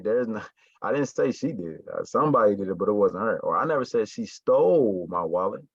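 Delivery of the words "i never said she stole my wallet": "stole" stretched and punched; stress on STOLE. Shown as a demonstration. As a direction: hit the word "stole". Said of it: "she didn't steal my wallet".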